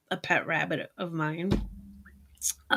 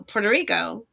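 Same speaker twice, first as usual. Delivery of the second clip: ordinary talk, nothing marked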